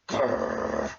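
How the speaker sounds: low engine growl. A narrator's reading. Diesel growling before sulking off. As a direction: growl in sulky defeat. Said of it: "he growled".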